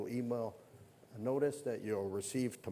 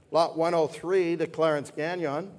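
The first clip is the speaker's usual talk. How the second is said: excited announcement; 6 semitones higher, 9 dB louder than usual. Acted. Announcing new lot with flourish.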